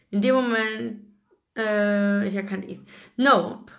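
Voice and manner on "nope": ordinary delivery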